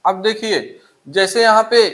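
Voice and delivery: ordinary delivery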